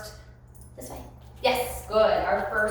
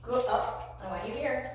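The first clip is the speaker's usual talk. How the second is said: ordinary talk, nothing marked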